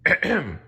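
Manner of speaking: loud royal throat clear; ahem, kingly. Acted. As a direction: clear your throat before speaking. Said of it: "said the king".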